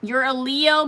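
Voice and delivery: usual speaking voice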